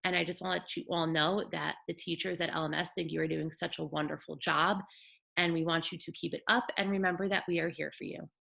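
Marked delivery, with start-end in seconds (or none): none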